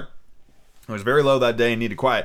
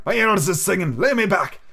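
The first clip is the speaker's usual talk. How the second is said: put-on raised, rough old seaman wheedling for rum; a sick old sailor coaxing the boy; old pirate captain; 8 semitones higher than usual. Weak captain.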